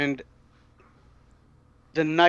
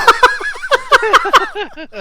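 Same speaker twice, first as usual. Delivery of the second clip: high, real burst of laughter; loud laugh; 8 dB louder than usual. Real, not acted. Player laughing about killing the officer, loud and high. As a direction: break up laughing.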